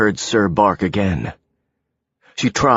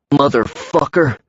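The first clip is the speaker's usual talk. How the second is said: sharp angry curse, pitch up; a swearing thug lashing out; cursing; 6 semitones higher than usual. A narrator's reading. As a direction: spit out the swear in rage. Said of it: "Mike cursed".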